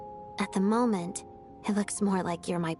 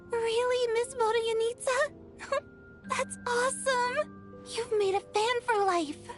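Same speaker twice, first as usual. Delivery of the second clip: excited; 5 semitones higher than usual. Acted. Girl thrilled by backstage pass.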